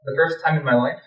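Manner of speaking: plain reading voice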